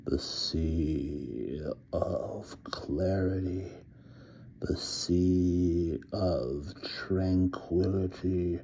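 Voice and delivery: a werewolf half-growling his words; inhuman growl. A narrator's reading. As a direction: drag each word out as the man turns to wolf. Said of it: "His voice grew more and more inhuman".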